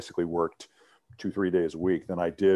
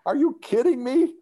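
amazed; 4 dB louder than usual. Retold or imitated. Amazed at low Chicago living costs.

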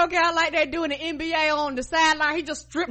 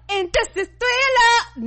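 higher, slower showy announcement; showman announcer; 7 semitones higher, 3 dB louder, slower than usual. Retold or imitated. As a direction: announce it like a stage show. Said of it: "He was like".

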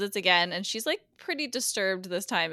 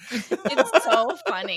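said through real laughter; laughing while saying it, slowly; 5 semitones higher, 6 dB louder, slower than usual. Real, not acted. Cracking up at the scene.

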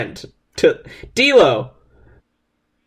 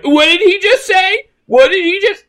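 high, baffled, rising; a hint of a confused commentator; mock King; 13 semitones higher, 3 dB louder than usual. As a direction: squeak out the disbelief. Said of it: "fucking King is like".

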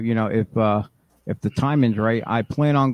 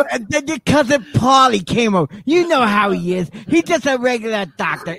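Yonkers mom; 9 semitones higher than usual. Pretend.

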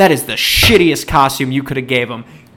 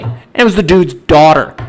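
usual speaking voice

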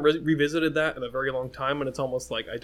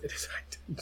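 1 whisper; 11 dB quieter than usual. Real, not acted. Whispering to herself.

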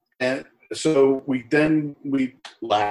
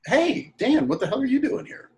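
put-on much higher, bright surprise at seeing an old regular; surprised greeting; 3 dB louder than usual. Greeting a restaurant regular, higher surprised call. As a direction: call out his name in happy surprise.

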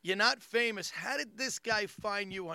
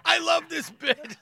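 high, loud, drawn-out enthusiasm; gushing fan; 8 semitones higher, 7 dB louder, slower than usual. Retold or imitated. Fan gushing to Keegan about the bit, loud and thrilled. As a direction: gush it slowly and loudly at the comic.

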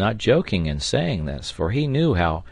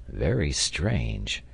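low, slow, soft musing; engineer's murmur; 5 semitones lower, 5 dB quieter, much slower than usual. A narrator's reading. Engineer puzzled by the fallen ladder, murmuring. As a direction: murmur it slowly, half to yourself. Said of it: "murmured the engineer".

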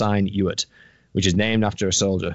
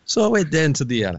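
lightly Scottish [name] storytelling; [name] impression. Host telling a District Nine plot in [name]'s Scottish voice. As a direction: summarise the film as [name] would. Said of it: "in the voice of [name]".

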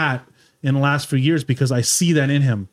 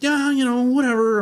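higher, shrugging mock Pat; imitating Pat Gooden; 7 semitones higher than usual. Telling friends the story, mimics Pat's offhand reply higher. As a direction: toss it off high like Pat not caring. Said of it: "I'm trying to do my best, Pat Gooden".

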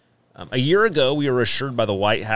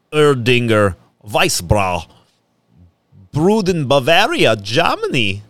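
more German than the last try; German accent; 3 dB louder than usual. Pretend. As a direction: lean into the German sounds. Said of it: "That's better. That's more German".

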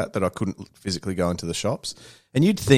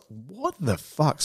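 slow, higher, softer disbelief; incredulous disbelief; 5 semitones higher, 5 dB quieter, much slower than usual. Retold or imitated. Customer retelling being refused a repair, incredulous. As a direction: draw it out in mock stunned disbelief.

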